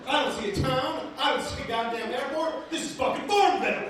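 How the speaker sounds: high, loud outraged passenger; shrill disbelief at where the plane is going; outraged lady. Retold or imitated. Passenger yelling that she sees no airport, high and loud. Lady six rows behind complaining.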